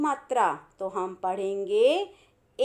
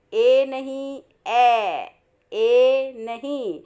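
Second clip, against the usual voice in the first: ए नहीं said louder, stressing the ए vowel; emphatic correction; 4 dB louder, much slower than usual. Shown as a demonstration. Teacher correcting pupils on the vowel, emphatic. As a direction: firmly set ए apart from ऐ. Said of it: "ए means छोटा ए".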